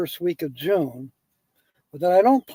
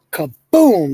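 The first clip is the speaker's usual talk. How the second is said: louder spoken kaboom as a sound effect; mock explosion; 7 dB louder than usual. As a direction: punch it out like a blast. Joke sound effect of wish granted.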